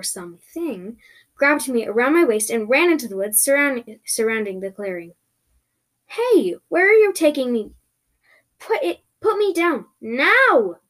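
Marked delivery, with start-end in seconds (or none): 6.1-10.8 high, loud protest; shouting. Acted. Shouting at the mystery person carrying them off, loud protest. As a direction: demand release at raised pitch and volume. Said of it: "shouted as the mystery person".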